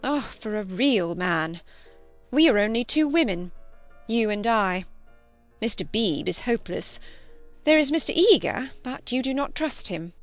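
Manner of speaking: prim cousin, mildly plaintive. A narrator's reading. Cousin lamenting to Lucy their lack of a man, plaintive. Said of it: "Miss Bartlett became plaintive".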